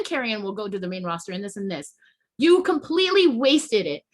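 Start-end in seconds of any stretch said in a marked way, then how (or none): 2.4-4.0 angry accusation. Real, not acted. Accusing the bookers angrily.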